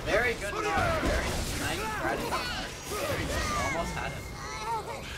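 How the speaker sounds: high, mocking sarcasm; sarcastic. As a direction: praise sarcastically. Mocking teammate's failure.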